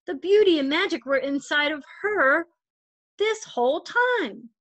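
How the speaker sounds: gentle storybook read-aloud voice; story narration. A narrator's reading. Volunteer reader telling a picture book to children, gentle storytelling.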